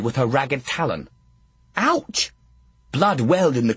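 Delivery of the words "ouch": sharp, high yelp of pain; startled ouch; sudden pain. Real, not acted. Hand scratched by talon.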